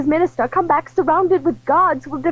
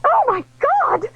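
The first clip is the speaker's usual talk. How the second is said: anguished cry; 10 semitones higher, slower than usual. Acted. Queen overwhelmed, begs him leave.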